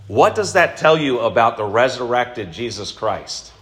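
ordinary talk, nothing marked

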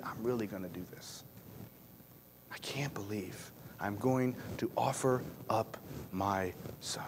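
a whispered, dreading thought; hushed inner thought. Acted. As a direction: barely breathe it, as if to himself.

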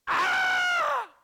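high, very loud mock shriek; mock scream. Pastor fakes terror at a scary picture, high loud shriek. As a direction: scream as if a monster jumped out.

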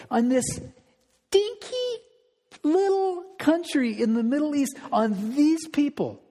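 jumps high on dinky, playfully diminishing; mock belittling. Pastor stressing how small Israel was, playful high pitch. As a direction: make the country sound tiny.

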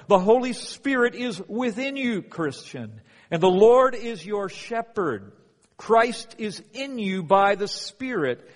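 rising pulpit fervour; fervent preaching. Acted. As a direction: preach it at full force to the congregation. Preaching climax on never alone.

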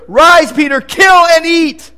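loud, high and slow heavenly command; booming heavenly voice. Acted. Voice from heaven commands Peter, booming and slow. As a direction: boom it out like a voice from heaven.

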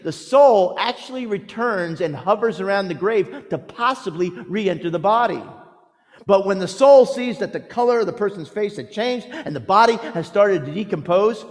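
usual speaking voice

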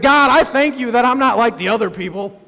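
a hint of a proud praying Pharisee looking down on others; smug Pharisee. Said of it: "I'm not sure if he spoke like that".

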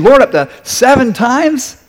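high, slow, pleased with his own generosity; proudly generous offer. Acted. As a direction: stretch it out as if offering a lot. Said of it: "Peter's being really generous".

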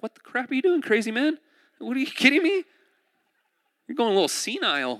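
high, frantic mock panic of a boy; freaked-out Isaac. Isaac panicking at his father, high and frantic. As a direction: squeal it like a terrified kid on the altar. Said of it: "Isaac's probably, like, freaking out".

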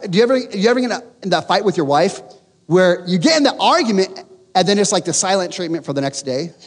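ordinary talk, nothing marked

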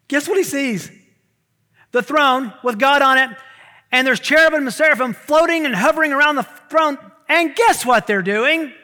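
loud, high, driving sermon voice; full-force preaching. Acted. Pastor building excitement about heaven's throne, preaching loud. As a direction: preach it to the back row.

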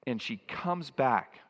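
normal talking voice

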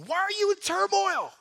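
high, loud, slow scolding; shouting at himself. Shown as a demonstration. Preacher yelling at himself like a drill sergeant, loud. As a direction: yell it at your own soul. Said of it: "You might need to shout at yourself".

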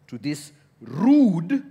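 ordinary talk

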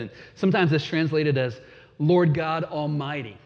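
normal talking voice

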